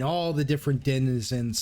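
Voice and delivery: ordinary talk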